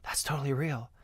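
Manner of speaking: soft, whispered gullible voice; mock whisper. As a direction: whisper it like a believer. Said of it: "people are like".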